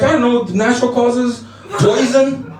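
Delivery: high, innocent mock-child voice; little girl. Eight-year-old pupil answers teacher's question, innocently nonchalant.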